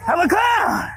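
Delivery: high shouted clown. Acted. Homie the Clown taunting the fleeing kids, high shouting. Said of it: "to be Homie the Clown".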